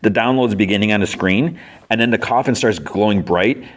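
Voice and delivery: usual speaking voice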